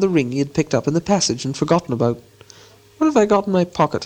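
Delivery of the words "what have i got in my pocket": higher, puzzled musing; said aloud to himself. A narrator's reading. Bilbo talking to himself about his pocket, puzzled. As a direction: wonder aloud, pitched up. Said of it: "he said aloud".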